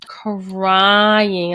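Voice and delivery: "crying" stretched out very slowly; long, exaggerated first syllable on "crying"; drawn-out emphasis. Real, not acted. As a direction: drag out "crying" for dramatic emphasis.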